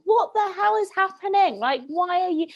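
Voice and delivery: high, incredulous retold reaction; bewildered disbelief. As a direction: demand an explanation in baffled disbelief. Retelling shock at friend's wheezing.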